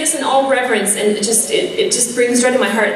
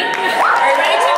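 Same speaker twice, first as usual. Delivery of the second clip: crowd hype shout; 10 semitones higher than usual. Real, not acted. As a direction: pump the audience up, shouting. Rousing the crowd at start.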